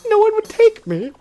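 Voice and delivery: sad villain. Retold or imitated. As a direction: whimper that nobody asked you. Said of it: "he was like".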